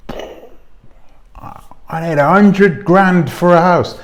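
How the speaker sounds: slow, high mock request for money; mock demand. Vlogger mimics people begging for a hundred grand, slow mock voice. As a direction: drag out the greedy ask. Mocking a big donation request.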